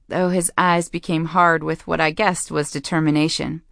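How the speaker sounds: ordinary delivery, nothing marked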